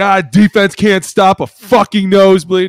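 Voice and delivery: loud, high, slow sung-out mock rant; angry rant. Player griping about defense, loud mocking rant. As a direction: belt it out like a fed-up player. Player ranting in locker room.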